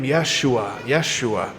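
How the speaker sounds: name from afar. Acted. Name heard from crowd.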